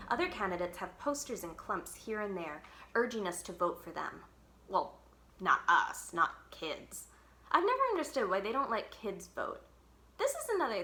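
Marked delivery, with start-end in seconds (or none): none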